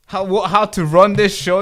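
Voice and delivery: loud, high, stumbling question; exasperated outcry. Real, not acted. Streamer loudly asking himself how the show works. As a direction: blurt it out in frustrated disbelief. Streamer baffled by broken setup.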